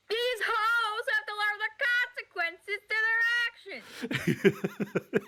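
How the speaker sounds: high-pitched, hammed-up outrage; mock-angry rant. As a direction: declare it in a silly, high voice, stretching the ending. Acts out own silly rant.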